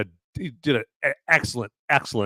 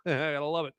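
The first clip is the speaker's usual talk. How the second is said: ordinary talk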